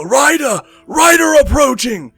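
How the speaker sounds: high, loud alarm yell from the watchtower; sentry yelling. Acted. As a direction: shout it across the storm at full voice. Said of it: "yelling in the night".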